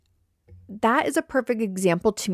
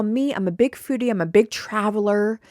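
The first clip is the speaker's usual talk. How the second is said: usual speaking voice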